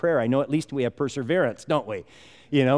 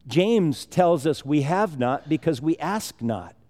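ordinary talk, nothing marked

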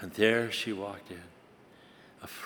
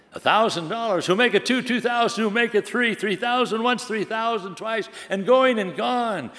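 much higher, louder bidding call; excited auctioneer; 10 semitones higher, 3 dB louder than usual. Acted. Auctioneer races bids in thousands, excited higher call. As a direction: sell it off fast and bright.

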